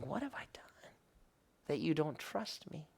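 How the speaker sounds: a near-whisper of divine grief; hushed plea. Retold or imitated. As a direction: breathe it like a hurt confession. The Lord's sorrowful question.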